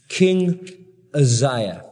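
usual speaking voice

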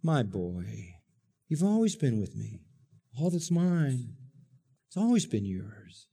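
soft, gentle reassurance; father gently pleading with the older son; tender father. Acted. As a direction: plead softly with your son. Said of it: "But he says".

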